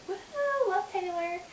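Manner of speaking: fangirl cheer. Real, not acted. Huge fan cheering for [name] to her viewers, high excited squeal. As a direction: cheer like a superfan at a concert.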